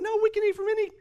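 high, fast voice for the woman; the preacher mimics Eve in a high voice for the church; mimicking the woman. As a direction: play Eve eagerly correcting the serpent. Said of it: "and she's like".

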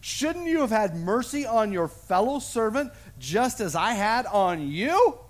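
king rebuking the unforgiving servant; angry master. Acted. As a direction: thunder at the wicked servant. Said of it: "And he said".

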